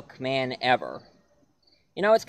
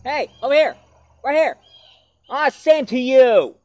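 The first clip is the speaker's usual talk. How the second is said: high, loud shouts at passing cars; shouting after cars that won't stop; calling out; 11 semitones higher, 8 dB louder than usual. Acted. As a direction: yell to flag down a ride.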